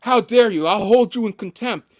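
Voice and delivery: loud, high-pitched imitation of outrage; mock screaming judge. Judge rebuking a lawyer over evidence, mock screaming. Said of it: "screaming at, uh, lawyers".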